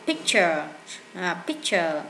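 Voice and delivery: "picture" ending in a weak schwa "-chờ"; English pronunciation demo. Shown as a demonstration. Teacher modeling "picture" for the class. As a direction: clip the end of "picture" to a light uh. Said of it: "Cái này các bạn thường đọc là ờ đó".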